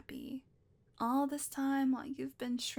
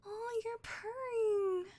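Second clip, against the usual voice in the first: much higher, slow delighted coo; cooing baby talk; 10 semitones higher, much slower than usual. Acted. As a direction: melt over the purring kitty. Cat purrs under her hand.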